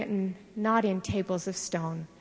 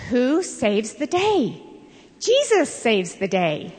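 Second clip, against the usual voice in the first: excited blurt; 3 semitones higher, 5 dB louder, slower than usual. Retold or imitated. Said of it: "used to blurt out".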